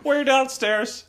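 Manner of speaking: high, slow, drawn-out dad imitation; mocked dad. Dad stating where they are, slow high mock voice. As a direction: stretch it out in a high mocking voice. Repeats the dad's line mockingly.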